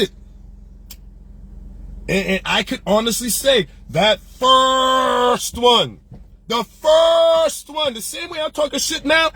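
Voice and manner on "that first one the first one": "first" held long and hard; passionate emphasis. Real, not acted. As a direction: stretch out the word first with passion. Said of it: "how passionate I am".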